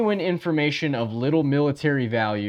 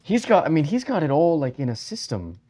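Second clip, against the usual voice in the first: raised put-on German accent; 4 semitones higher than usual. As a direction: play up a terrible German accent. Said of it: "that's a terrible German accent".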